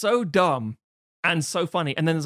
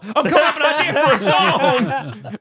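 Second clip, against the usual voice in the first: high, loud, rushed outburst; hammed-up shouting through laughter; mock excited shout; 11 semitones higher, 9 dB louder, faster than usual. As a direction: yell it like a sudden revelation. Acts fight turning into song idea.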